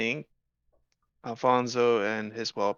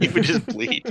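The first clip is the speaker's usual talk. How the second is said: laughing; 8 semitones higher, 5 dB louder than usual. Real, not acted. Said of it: "I heard yes".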